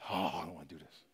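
understated dread, as if praying; reluctant plea. Acted. Jesus before dying pleads to the Father, reluctantly. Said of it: "what he asked the Father".

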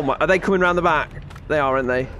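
normal talking voice

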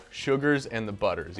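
ordinary talk, nothing marked

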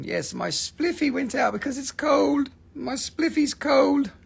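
squeaky mock baby talk; mock whiny voice. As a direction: pout it like a sulky child. Mocking viewer's cold spliff.

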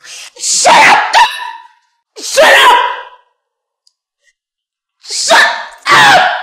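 very high, loud bursts; furious screaming. Acted. Mother drowning out the girlfriend's plea, screaming in fury. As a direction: scream it in explosive, separated blasts.

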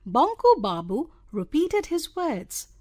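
ordinary delivery, nothing marked

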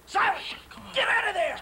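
urgent high yell; shouting. Acted. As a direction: yell a warning to get out. Shouting to the trapped mute.

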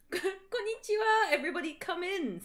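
lightly voiced teacher, bright hello; Japanese greeting. Professor Sinister welcomes the class, cheery mock greeting. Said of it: "said Professor Sinister in Japanese".

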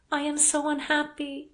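high, distressed woman's voice; unhappy heroine. A narrator's reading. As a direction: pour out the misery, heedless of him. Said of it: "she went on, not heeding him".

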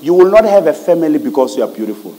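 normal talking voice

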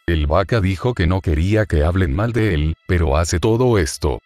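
usual speaking voice

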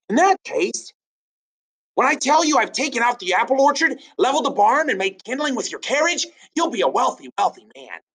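fast, very high eager confession; high cheeky boy. Acted. George cheerfully listing damage to his father, high and fast. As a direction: rattle off the damage brightly.

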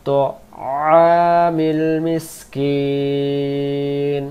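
slow, drawn-out melodic tajweed chant; Quran recitation. Acted. Teacher chanting verses for students in slow tajweed. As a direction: stretch each vowel long in recitation.

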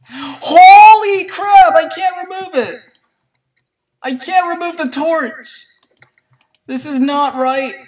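high, slow, loud exclaiming; alarmed high drawn-out cry; frantic. Real, not acted. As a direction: panic over the stuck torch. Cannot remove torch.

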